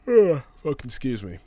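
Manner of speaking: talking through a yawn. Real, not acted. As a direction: yawn mid-sentence. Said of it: "Sorry, I'm yawning".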